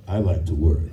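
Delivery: ordinary talk, nothing marked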